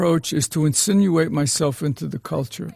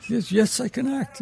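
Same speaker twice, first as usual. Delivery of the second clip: high-pitched eager Irish cab driver; amateur imitation of an Irish driver; attempted Irish accent; 8 semitones higher than usual. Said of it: "I can't do the accent".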